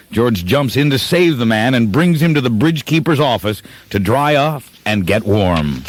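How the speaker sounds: ordinary talk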